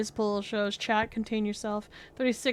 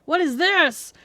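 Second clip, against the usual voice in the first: acted alarm; 5 semitones higher, 8 dB louder than usual. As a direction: cry out as if voices invade your head. Character alarmed by voices in head.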